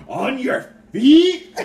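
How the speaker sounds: On your feet? shouted high and slow, rising; mock shocked question. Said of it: "Topher's reaction".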